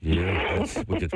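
ordinary delivery

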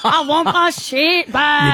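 slow, hammed-up Scottish-sounding complaint; Irish gone Scottish. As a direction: wail it in a botched Irish-Scottish accent. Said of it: "You're going Scottish".

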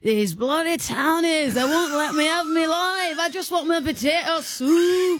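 mock Northern English farmer grumbling about the townies; Northern English accent. Irish farmer act slipping into a mock Northern English accent. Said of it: "That's Northern England".

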